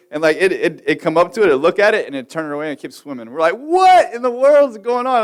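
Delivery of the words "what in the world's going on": high, loud, drawn-out cry of disbelief; baffled exclamation. Retold or imitated. Fisherman baffled by a bass ignoring bait, high slow exasperated cry. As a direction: throw the words up in exasperated wonder.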